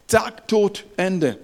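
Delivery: high, loud punched words; abrupt exclamation. Retold or imitated. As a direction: act out a sudden blow. Sudden killing in retold story. (plötzlicher, lauter Ausruf)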